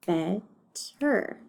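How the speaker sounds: BETTER split in two, bet, then ter; broken into syllables. Shown as a demonstration. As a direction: count out BETTER with a gap between syllables. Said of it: "decide how many syllables it has".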